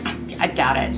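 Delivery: a hint of a Michigan local doubting; Michigan accent. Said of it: "your response could be like".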